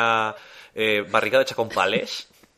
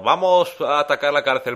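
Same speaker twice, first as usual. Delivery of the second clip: loud, high gloating villain line; sneering panto villain; 5 semitones higher, 7 dB louder than usual. Retold or imitated. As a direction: announce the attack like a hammy baddie. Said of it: "dice el gobernador".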